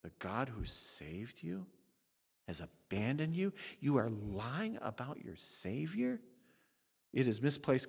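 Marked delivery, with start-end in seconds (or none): 0.0-6.2 soft, firm rebuke of a hopeless believer; gentle and firm. Retold or imitated. Preacher voicing a pastor counseling a despairing believer, gentle firm rebuke. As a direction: correct them gently but firmly. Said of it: "We talk gently and firmly".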